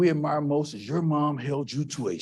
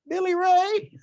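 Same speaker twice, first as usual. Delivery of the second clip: high, stern call of his name; mother calling his name; 3 dB louder, slower than usual. Retold or imitated. Mom calling her son to the living room, sternly.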